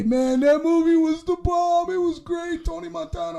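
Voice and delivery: high, mocking fan voice gushing; a mocking fanboy rave, high. Mocking Scarface fans.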